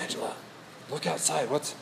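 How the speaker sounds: softly rousing a sleeping wife; hushed urgency. Acted. Waking wife as flashlights approach, hushed urgency.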